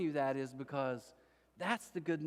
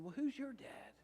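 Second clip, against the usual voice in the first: higher and softer, gentle pastor asking; a softly raised pastor's question; 10 semitones higher, 8 dB quieter than usual. Retold or imitated. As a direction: ask it kindly, almost hushed. Pastor asks the dreaded question.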